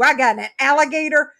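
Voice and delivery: normal talking voice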